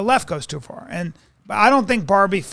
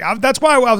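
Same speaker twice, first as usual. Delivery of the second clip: usual speaking voice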